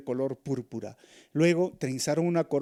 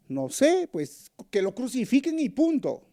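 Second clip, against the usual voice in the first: much higher, loud dismissive reply; mob answer; 10 semitones higher, 4 dB louder than usual. Retold or imitated. Crowd member answering the reporter, loud and dismissive. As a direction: toss it off like a stubborn mob member.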